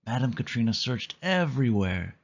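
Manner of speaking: ordinary delivery, nothing marked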